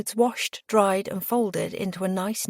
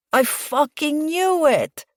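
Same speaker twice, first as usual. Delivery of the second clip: high, drawn-out triumphant exclamation; amused, higher and slower exclamation; gleeful outburst; 9 semitones higher, 6 dB louder, slower than usual. A narrator's reading. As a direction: crow with delight, stretching it out. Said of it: "laughing and spilling his coffee".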